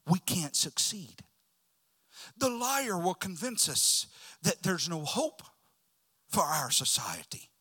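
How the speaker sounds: ordinary talk, nothing marked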